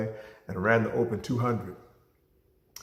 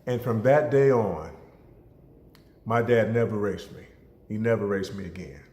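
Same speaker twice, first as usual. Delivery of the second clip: ordinary talk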